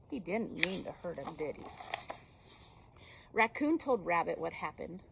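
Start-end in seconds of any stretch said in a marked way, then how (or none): none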